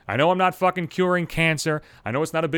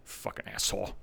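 whispered, much softer; disgusted whisper; muttered aside; 7 semitones lower, 13 dB quieter than usual. Real, not acted. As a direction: mutter it away from the mic.

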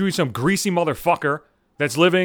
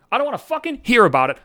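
hear pushed up high in anger; fed-up exclamation, pitched up on hear; angry higher repeat; 7 semitones higher, 3 dB louder than usual. Real, not acted. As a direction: repeat it with rising fury. Repeats rant line higher.

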